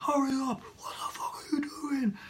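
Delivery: high-pitched mock yelling, held back in volume; exaggerated angry drivers. Cars queued behind a stalled learner, exaggerated mock impatience. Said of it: "Probably not that exaggerated".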